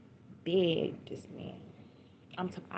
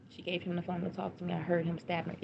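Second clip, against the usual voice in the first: ordinary talk, nothing marked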